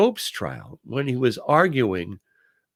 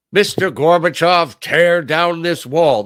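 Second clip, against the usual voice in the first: loud, ringing podium delivery; Ronald Reagan; 5 semitones higher, 9 dB louder, slower than usual. Retold or imitated. Quoting a presidential speech to listeners, loud oratorical delivery. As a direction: declaim it like a famous speech, high and slowing. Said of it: "Ronald Reagan was an actor".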